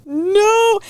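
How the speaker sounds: high loud wailed no; a sob-choked high no; mock sobbing no. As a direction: whine it like a crying man. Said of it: "just starts sobbing".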